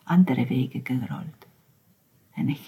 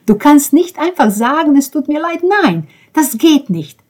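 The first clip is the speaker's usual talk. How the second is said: anguished protest; 9 semitones higher, 3 dB louder than usual. Acted.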